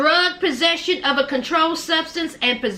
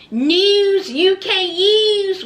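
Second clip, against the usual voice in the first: drawn-out catchphrase; 5 semitones higher, 4 dB louder, much slower than usual. Pretend.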